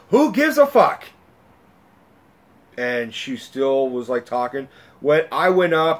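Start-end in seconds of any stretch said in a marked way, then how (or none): 0.1-1.0 loud, high retold shout; fed-up outburst. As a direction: re-enact snapping at a noisy moviegoer. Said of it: "I finally had enough".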